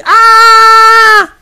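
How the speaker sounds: piercing high scream, full force; demo scream of pain. Shown as a demonstration. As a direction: let out a loud cry of agony. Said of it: "une personne qui crie".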